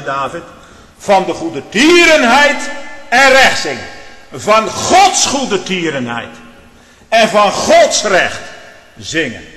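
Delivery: impassioned Dutch preaching at full volume; full-force preaching. Acted. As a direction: preach it out, lifting the congregation.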